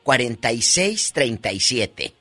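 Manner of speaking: slow dictation. Real, not acted. Reading the phone number back.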